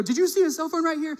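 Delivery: high, urgent, near-yelling question; intense yell. Retold or imitated. Pastor asking a stranger about his lost phone, frantic and high. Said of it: "yelling just from intensity".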